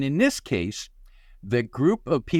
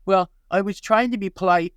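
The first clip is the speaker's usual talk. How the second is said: higher, louder, meek excuse; a caller shrinking back, defensive; feeble excuse; 6 semitones higher, 3 dB louder than usual. Retold or imitated. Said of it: "so feebly".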